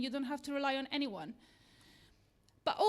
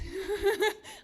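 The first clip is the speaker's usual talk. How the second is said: bright burst of laughter, higher and louder; short real laugh; 6 semitones higher, 5 dB louder than usual. Real, not acted. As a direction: laugh freely at the interruption. Audience interjects, speaker laughs.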